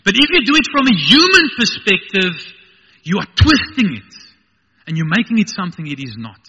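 very high, loud, insistent preaching; full-force preaching. Real, not acted. Preacher warning against human-centred retelling, full-force preaching. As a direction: raise the voice high and drive the warning home.

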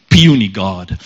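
low-pitched, dismissive brute voice; Hulk. Retold or imitated. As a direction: drop the pitch like a scornful giant. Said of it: "Hulk grabs him by the ankle".

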